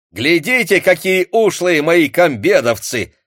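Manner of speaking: high loud bragging; proud boast. A narrator's reading. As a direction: show off his men. Akim boasting to the secretary. (Аким хвастается секретарю, возбуждённо)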